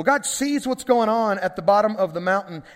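normal talking voice

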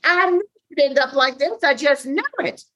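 high, told-you-so mother voice; imitating his mother. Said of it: "I could just hear her".

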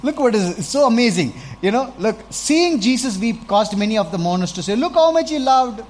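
loud, high amazement; preacher marvelling at mourners seeing Jesus weep, loud and high; awed excitement. Real, not acted. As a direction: lift the whole passage up with wonder.

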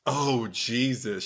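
ordinary talk

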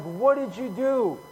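high, drawn-out exasperated demand; acted freaking out. Frightened sailors turning on Jonah, high freaked-out demand. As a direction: play a sailor losing his cool. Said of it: "they're, like, freaking out".

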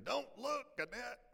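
high-pitched mock scream; imitated panicky shriek, held back in volume; retold scream. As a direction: cry it out very high, at no great volume. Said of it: "I was screaming".

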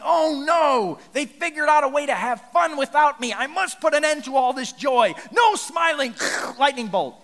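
high, loud, hammed-up killjoy ranting; mock cranky God. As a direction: play a petty tyrant god shouting down all joy. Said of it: "sitting there going".

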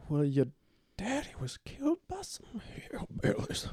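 put-on high, hushed old-lady whisper; whispering old grandma. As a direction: breathe it like a frail granny. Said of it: "I'm his grandmother".

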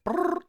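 high trilled phone ring sound; phone ring noise. Shown as a demonstration. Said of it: "Go ahead".

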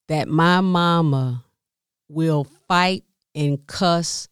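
ordinary delivery

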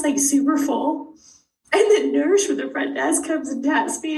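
usual speaking voice

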